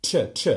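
crisp ch sound, tongue-tip then release; الصوت تش بوضوح. Shown as a demonstration. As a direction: say تش as a crisp ch like church. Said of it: "المعتاد بتاعه اللي هو تش".

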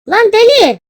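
high, loud outburst; startled yell. Real, not acted. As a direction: yell out at another player. Startled by another player. (şaşkınlıkla bağırarak)

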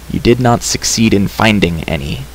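ordinary delivery, nothing marked

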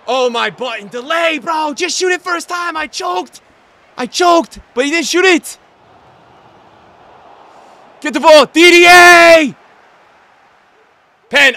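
high, loud rant at the game; frustrated yelling. Real, not acted. Gamer yelling at his striker for not shooting, frustrated shout. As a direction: yell at the player who won't shoot.

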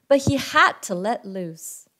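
ordinary delivery

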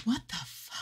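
high, soft whisper overcome by feeling; choked up. Real, not acted. Reader overwhelmed by "wandering star," really choked up. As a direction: squeeze it out through rising emotion. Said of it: "I'm getting choked up".

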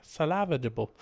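"salvageable" stressed on the wrong syllable, "LA"; Vine-guy mispronunciation. Retold or imitated. Player imitating a Vine guy, "salvageable" weirdly pronounced as sa-LA-va-di-ble. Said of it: "that Vine guy who pronounces everything weird".

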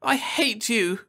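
put-on high, slow childish wail; wailing girl. A narrator's reading. Daughter wailing at mother after the wall shatters, high and slow. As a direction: hurl it at her mother tearfully.

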